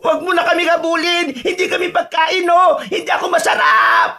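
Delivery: high, shouted begging; panicked pleading. Acted. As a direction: beg the monster to spare you. Pleading with the pursuing monster.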